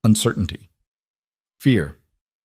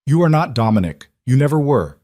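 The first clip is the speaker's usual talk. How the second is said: fierce mother, pushed louder and higher; 5 semitones higher, 4 dB louder than usual. A narrator's reading. Mother confronting her son about his father, fierce. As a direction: lay into him, fierce and firm. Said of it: "Katerina's voice turned fierce".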